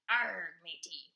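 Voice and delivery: slow growly pirate; lightly voiced pirate. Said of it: "That's my pirate voice".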